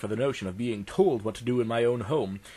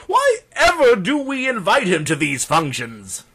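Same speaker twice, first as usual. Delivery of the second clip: loud, higher-pitched mock groaning; groaning complaint; 6 semitones higher, 7 dB louder than usual. Doctor imitating guests who dread his arrival, loud groaning complaint. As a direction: moan theatrically, imitating exasperated party guests. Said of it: "greeted with weary groans and cries of".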